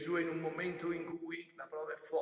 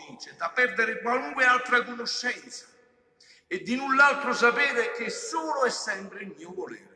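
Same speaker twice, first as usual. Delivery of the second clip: loud, raised preacher's voice; forceful preaching; 3 semitones higher, 9 dB louder than usual. Real, not acted. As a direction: drive the words home loudly to the congregation.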